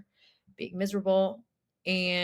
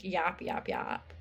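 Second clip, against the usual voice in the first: mock yapping; 3 semitones lower, 7 dB quieter, slower than usual. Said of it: "we're like".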